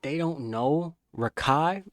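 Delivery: slow, loud, every word leaned on; hype emphasis. Real, not acted. Streamer hyping up Rakai to chat, slow and emphatic. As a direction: drag it out to hype him up.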